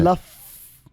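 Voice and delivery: "Laf" with a clear, strong F; Arabic pronunciation. Shown as a demonstration. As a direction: model "Laf" for a learner, leaning on the F. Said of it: "You have to pronounce the F".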